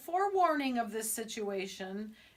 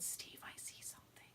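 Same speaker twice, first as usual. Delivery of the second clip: hushed whisper to a hunting partner; 21 dB quieter than usual. Retold or imitated. Wife alerting her husband in the tree stand, whispering. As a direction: whisper it over your shoulder. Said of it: "I whisper to him".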